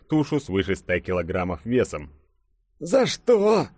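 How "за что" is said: high, loud, strained cry; wheezed protest. A narrator's reading. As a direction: protest as if crushed under a heavy weight. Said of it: "прохрипел Фадеев". (сдавленный высокий возглас)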